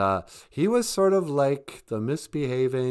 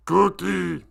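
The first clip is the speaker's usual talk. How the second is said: high, loud monster cry; Cookie Monster; 8 semitones higher, 5 dB louder than usual. Retold or imitated. A streamer imitating the Cookie Monster for chat, high and loud. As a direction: bark out "Cookie!" like the puppet. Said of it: "[name] had Cookie Monster down there".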